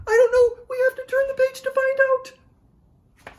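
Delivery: very high-pitched dramatic suspense; przesadne napięcie. Acted. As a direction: squeal it with mock suspense. Building suspense for young listeners.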